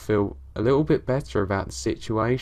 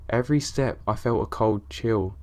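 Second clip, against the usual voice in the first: ordinary delivery, nothing marked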